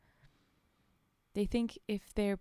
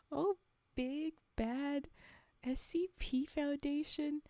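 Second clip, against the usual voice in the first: higher, sarcastic sing-song; mocking; 6 semitones higher than usual. Real, not acted. Reader mocking the SCP Foundation's prison logic, sarcastic. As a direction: tease the big bad Foundation. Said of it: "mocking the Foundation".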